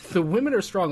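high, loud triumphant quote; loud mock exclaim. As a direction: belt it out proudly. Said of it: "It's like".

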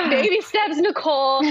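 bright teasing gasp; playful tease. Real, not acted. Teasing reply to friend.

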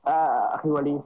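distant, quiet phone voice; speaking too far from the microphone; far from mic. Real, not acted. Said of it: "صوتك كتير بعيد عن الميكروفون".

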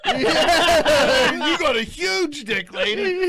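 high, loud retold comeback; mock taunting shout. Storyteller re-enacts his comeback to the lady, mock taunting shout. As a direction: yell it back through amusement.

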